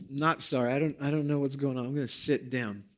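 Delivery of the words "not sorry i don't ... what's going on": ordinary delivery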